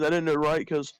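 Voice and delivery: normal talking voice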